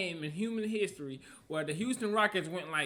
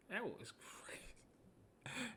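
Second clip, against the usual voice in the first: hushed, slow whisper of disbelief; stunned whisper; 18 dB quieter, much slower than usual. Real, not acted. Viewer reacting to a one-foot fade three, awed whisper. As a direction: whisper it slowly, almost to yourself.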